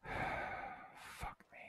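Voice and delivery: a defeated whispered curse; exasperated whisper. Real, not acted. Gamer giving up on the war, sighed whispered curse. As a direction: sigh it out under the breath. Sighing over lost war.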